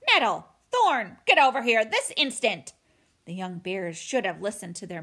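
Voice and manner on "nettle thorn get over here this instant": loud, commanding scolding shout; angry mother bear's loud summons; furious mother. A narrator's reading. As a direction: call your cubs in sharply at full voice. Furious mother bear summons cubs.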